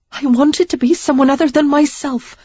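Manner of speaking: Sarah defiantly confessing, in misery. Acted. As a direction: stand up for herself through the hurt. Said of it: "in defiant misery".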